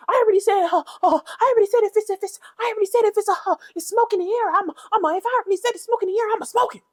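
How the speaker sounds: high-pitched, rapid stammering mockery; mimicking castmate. Vlogger mocking a castmate's fumbled line, high rapid mimicry. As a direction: imitate her stumbling over her line, way up high. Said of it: "trying to s-, repeat exactly what she has said".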